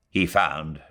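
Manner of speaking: plain reading voice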